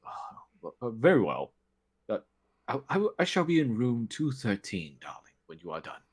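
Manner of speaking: a hint of wounded pride; deflated noble. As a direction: give in with a sniffy "very well". Nobleman yields, sulking.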